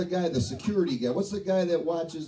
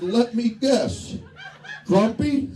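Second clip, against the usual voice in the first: sarcastic guess; 7 semitones higher, slower than usual. Retold or imitated. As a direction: tease him, drawing it out. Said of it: "And I said".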